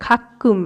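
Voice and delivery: খাক্কুম with a tense kk; hold, then release the k in খাক্কুম; doubled ক. Shown as a demonstration. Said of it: "সেরম কিন্তু উচ্চারণটা হচ্ছে". (খাক্কুম-এ জোড়া ক)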